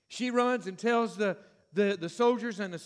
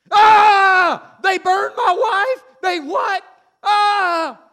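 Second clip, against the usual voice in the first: very high, very loud enraged screaming; anguished furious scream; 10 semitones higher, 14 dB louder, much slower than usual. Acted. Samson raging at those who burned his wife, screaming. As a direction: scream it out in grief and fury.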